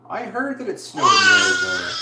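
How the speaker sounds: not an inside voice; loud shout. Real, not acted. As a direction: shout it across the room. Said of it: "Abby, inside voices, please".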